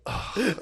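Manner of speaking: a high, soft, laughing groan of weariness; mock groan. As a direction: groan like you're drained. Imitating drained people groaning.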